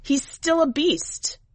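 high, slow whine; a wife whining to the witch. A narrator's reading. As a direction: drag it out as a plaintive whine. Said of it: "more of a whine than an accusation".

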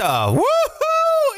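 high, drawn-out woohoo; long celebratory whoop; excited cheer. Real, not acted. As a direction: whoop to open the show. Host's show opening cheer.

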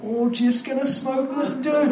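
high, drawn-out gleeful anticipation; imitated hyped-up spectator; excited onlooker. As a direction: voice a thrilled kid expecting a fight. Voices excited boys expecting a fight.

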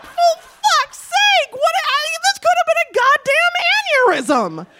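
put-on a boyfriend with a burst blood vessel yelling at his girlfriend; exasperated shout. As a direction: rage at her for not taking it seriously. Furious boyfriend.

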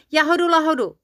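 high, loud cry to get in first; insistent high-pitched exclamation; excited shout. A narrator's reading. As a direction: shout it out, racing to beat your rival. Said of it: "vykřikl Žilka".

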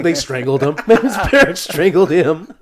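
loud, high-pitched, real laughing speech. Real, not acted. Co-host joking about the parents' role, laughing hard. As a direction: crack up while pushing the words out. Laughing at own absurd theory.

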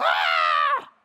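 high, loud mock scream of panic; panicked scream. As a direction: shriek like people caught in a storm. People panicking in the storm.

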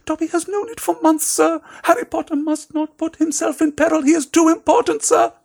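squeaky house elf. A narrator's reading. Dobby begs Harry Potter to stay home, high frantic squeak. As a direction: squeak it out like a panicked little elf.

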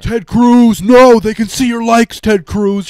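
high, loud plea of alarm; mock alarmed shout. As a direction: yell a hammed-up warning across the room. Mock warning to a senator.

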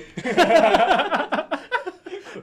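high-pitched burst of laughter; high giggle. Real, not acted. Laughs at own joke.

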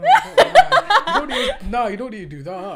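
high, loud laughing no, don't; laughing protest. Real, not acted. Guest begging the host not to show the clip, laughing. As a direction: beg her not to, laughing hard.

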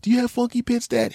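put-on high child's voice; curious kid. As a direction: ask like a wide-eyed boy. Kid asks dad.

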